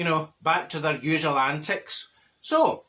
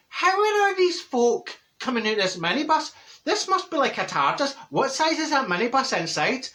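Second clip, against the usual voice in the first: incredulous; 7 semitones higher than usual. Retold or imitated. Neighbour baffled by all the folk from the minibus, incredulous. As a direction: retell it in high-pitched amazement. Retells amazed reaction to crowd.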